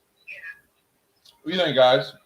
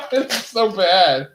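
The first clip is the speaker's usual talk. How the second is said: laughing; 12 semitones higher, 5 dB louder, much slower than usual. Real, not acted. Laughing at himself.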